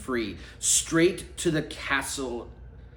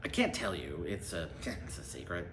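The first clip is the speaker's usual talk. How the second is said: softer, lower, with a sly laugh; sly secret; 6 semitones lower, 7 dB quieter, faster than usual. Acted. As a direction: tease them with a secret. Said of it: "the shifty knight smiled".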